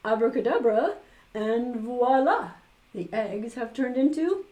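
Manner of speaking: loud, hammed-up magician's announcement; booming stage magician's abracadabra; showy magician. A narrator's reading. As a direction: announce the trick with grand flourish. Magician performing a trick.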